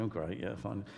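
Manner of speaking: low, very soft mock acceptance; unimpressed reply. Son answering his mum's excuse, low deflated aside. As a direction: drop right down, under the breath.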